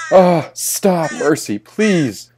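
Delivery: high, loud cry for mercy, drawn out; pained pleading. A narrator's reading. Tormented narrator begging a crying crow to stop, high pleading cry. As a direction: beg loudly for it to stop, dragging the last words.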